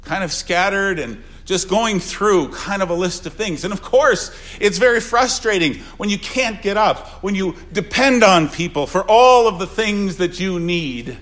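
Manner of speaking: earnest pulpit delivery; preaching. Acted. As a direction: speak for the dying woman's frustration. Preaching at full force.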